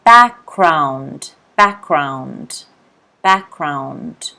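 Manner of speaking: Background said as "backround", the G dropped; teacher modelling Background without the G; G not pronounced. Shown as a demonstration. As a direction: say Background with no G sound, stress on "back". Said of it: "the G is not pronounced".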